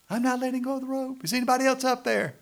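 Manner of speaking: high, loud acted protest; panicked refusal. Man hanging on the rope answers the voice, in loud high protest. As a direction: yell back up in a high, alarmed voice. Man in well argues back.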